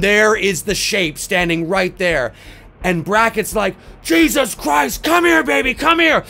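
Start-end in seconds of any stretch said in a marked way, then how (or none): 4.0-6.2 high, loud frantic yell; panicked shout. Retold or imitated. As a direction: scream for her to run to you. Sees the killer behind girl.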